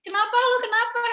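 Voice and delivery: mom pressing, voiced high and loud; nagging mom, loud and high; pushy mom. (ibu yang mendesak, suara tinggi dan keras)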